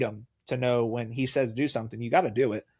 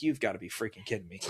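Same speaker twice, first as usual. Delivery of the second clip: muttered disbelief; 4 semitones lower, 10 dB quieter, faster than usual. Retold or imitated. Retold disbelief at altar call.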